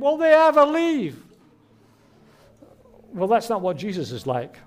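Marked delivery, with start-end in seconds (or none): 0.0-1.2 loud, high-pitched exasperated wail; mock exasperation. Parent wondering about adult children still at home, comically exasperated. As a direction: cry it out like a fed-up parent.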